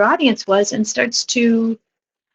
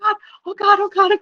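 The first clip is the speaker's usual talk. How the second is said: retold overwhelmed outburst; 12 semitones higher, faster than usual. Retold helpless laughing fit at wedding.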